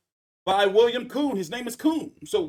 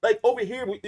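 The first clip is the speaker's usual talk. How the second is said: ordinary talk, nothing marked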